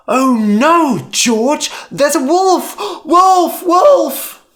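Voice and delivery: high, loud panicked cry. A narrator's reading. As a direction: scream the alarm at George. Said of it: "Lyman cried".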